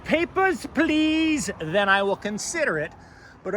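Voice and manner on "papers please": mock German officer, much higher, barking Papers, please in a German accent; hammed-up German accent demanding papers; thick German accent. Imagined police officer demanding papers from the photographer, thick German accent. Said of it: "they say in a thick German accent".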